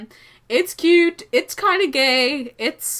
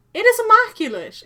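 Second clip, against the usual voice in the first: excited; 13 semitones higher, 8 dB louder, faster than usual. Real, not acted. Repeats line with emphasis.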